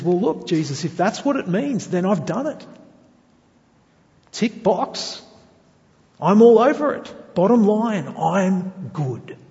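lightly acted, cocksure reply; mock self-assurance. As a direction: tick it off as if it's all settled. Voices the self-satisfied man answering Jesus.

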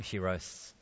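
ordinary talk, nothing marked

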